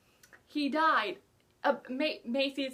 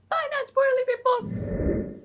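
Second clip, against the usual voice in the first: much higher, louder, slow sing-song farewell; playful goodbye; 13 semitones higher, 9 dB louder, slower than usual. Pretend. Reviewer shooing off viewers avoiding spoilers, sing-song bye. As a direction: chirp a cheery bye to the viewers.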